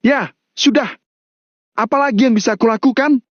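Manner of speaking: high, heated comeback; high-pitched acted exasperation; exasperated retort. A narrator's reading. Chris retorts in shouted quarrel.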